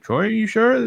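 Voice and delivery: high-pitched, rising doubtful question; mock disbelief. Sister questioning brother's news, mock-incredulous.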